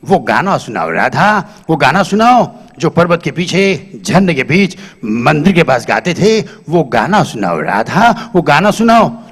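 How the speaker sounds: low, slow, filmy dying speech; dying hero. Retold or imitated. Dying Raaj Kumar asks Radha for their song, low and slow. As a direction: plead grandly for the song, in a deep voice.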